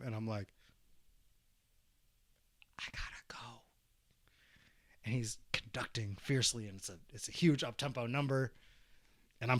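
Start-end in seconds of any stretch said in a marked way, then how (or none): 2.8-3.6 small, breath-only desperate whisper; whispered mock plea. Student to conducting teacher, needing to pee, whispered plea. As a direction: whisper it like a secret emergency.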